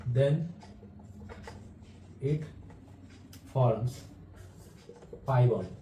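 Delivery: word-by-word dictation with long pauses; measured teacher dictating notes, halting after each phrase. Real, not acted. Teacher dictating a definition for students to write down, dictation.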